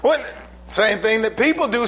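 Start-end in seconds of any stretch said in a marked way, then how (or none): none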